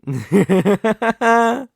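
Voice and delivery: loud, high gloating villain laugh; villainous laugh. Acted. Villain mocking a rival's tears, a high gloating laugh. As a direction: laugh down at the rival you've cornered.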